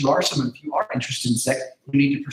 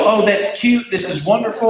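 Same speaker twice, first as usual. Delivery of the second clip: higher, louder, sugary cooing; mock sweet gushing; 6 semitones higher, 4 dB louder than usual. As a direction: gush over it like a doting parent. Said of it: "You wouldn't go".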